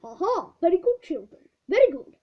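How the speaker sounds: a touch of amused praise; amused teacher. Acted. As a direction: chuckle and praise the children. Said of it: "most amused by his pupils' efforts".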